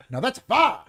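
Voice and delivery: high, put-on voice of a proud uncle; "far" as a broad, one-syllable "fire"; Uncle Gus from Eddie Murphy's routine. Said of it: "Uncle Gus would come and he'd be like".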